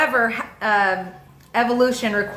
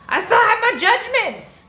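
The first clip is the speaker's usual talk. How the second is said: laughing admission; 13 semitones higher than usual. Real, not acted.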